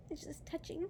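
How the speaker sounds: overcome, barely getting it out; teary laugh into a whisper. Real, not acted. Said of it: "I'm trying not to cry".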